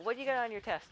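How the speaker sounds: high-pitched call-out, imitating a classmate; retold yell. Classmate asking about the test in class, retold yell. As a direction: mimic a kid calling across the room. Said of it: "He yells out".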